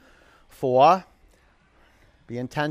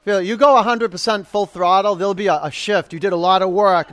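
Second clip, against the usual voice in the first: loud, driving coach's push; forceful, raised-voice encouragement; urging pep talk; 3 semitones higher, 7 dB louder than usual. Real, not acted. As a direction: urge the class to give everything. Coach urging full effort before finisher.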